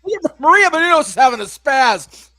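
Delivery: high, loud shocked cry; shocked shout. Real, not acted. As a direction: yell it in disbelief. Reacting to a brutal move.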